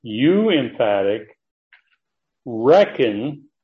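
"You" drawn out slowly, "reckon" punched after a pause; strong emphasis. Shown as a demonstration. Teacher showing the double emphasis in the verse, emphatic "You". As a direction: hit "You" and "reckon" as separate, weighty words. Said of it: "strong emphasis".

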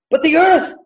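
But the earth in a high ringing call; emphatic earth. Shown as a demonstration. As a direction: throw earth up high to grab attention. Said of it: "So it's emphatic".

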